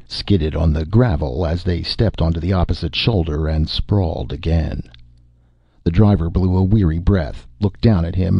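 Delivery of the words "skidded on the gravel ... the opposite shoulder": ordinary delivery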